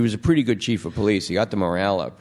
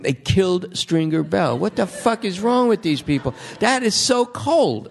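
much higher, slow, outraged wail; upset dealers; 11 semitones higher than usual. Retold or imitated. Wiretapped dealers mourn Stringer Bell, high and outraged. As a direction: mimic dealers aghast at the killing. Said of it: "drug dealers going".

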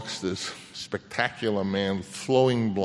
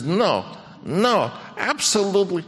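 light imitation of a Viennese accent, much higher; a hint of a surprised Viennese boss, pitched up; 11 semitones higher than usual. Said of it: "he sort of had a Viennese accent".

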